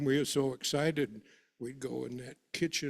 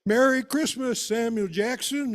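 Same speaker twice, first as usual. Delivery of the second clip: much higher and louder holiday shout; yells out; 8 semitones higher, 8 dB louder than usual. Retold or imitated. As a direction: belt the greeting across the yard to the neighbour. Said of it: "Papa yells out".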